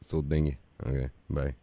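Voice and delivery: very low, soft sheepish reply; meek low voice. Acted. Prank caller after being yelled at, meek low voice. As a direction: shrink back deflated.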